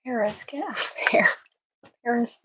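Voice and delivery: hushed telling off; whispered scolding. Real, not acted.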